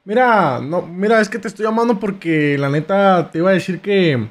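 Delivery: usual speaking voice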